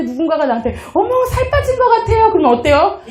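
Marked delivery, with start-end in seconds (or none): 0.9-2.3 high, bright flattering gush. Retold or imitated. A stranger flattering the speaker about weight loss, gushing high. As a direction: gush a compliment.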